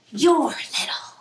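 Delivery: whispered, almost to herself. Acted. Lulu squinting at distant brother, whispered taunt. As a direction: whisper it with a secret smile. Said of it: "she says".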